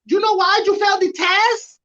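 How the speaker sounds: high mocking sergeant question; first sergeant voice. First sergeant quizzing a failed soldier, high mock voice. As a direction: ham up the sergeant's smug question. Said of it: "and he goes".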